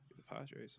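breathy whisper, almost silent. Real, not acted. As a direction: whisper it under your breath. Repeating the team name softly.

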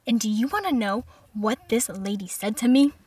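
normal talking voice

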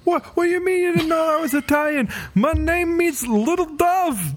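very high, put-on Italian-American Columbo voice; indignant Columbo, hamming up the Italian accent. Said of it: "more stereotypically Italian".

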